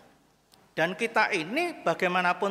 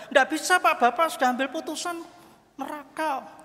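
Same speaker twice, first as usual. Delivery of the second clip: high, insistent overruling; firm acted refusal; 11 semitones higher, 4 dB louder than usual. Angel refuses Mukidi's change of mind, high insistent refusal. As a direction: cut off the plea, pitched up and emphatic.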